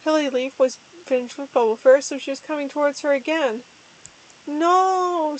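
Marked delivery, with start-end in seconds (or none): 4.5-5.4 mild wail. Acted. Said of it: "Starling wailed".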